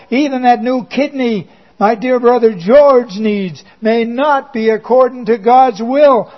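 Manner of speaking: ordinary talk, nothing marked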